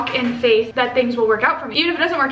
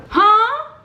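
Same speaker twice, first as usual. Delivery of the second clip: high, loud baffled "huh"; incredulous; 10 semitones higher, 5 dB louder than usual. Real, not acted. Vlogger reacting to the uncanny coincidence, incredulous. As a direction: shoot it up high in disbelief.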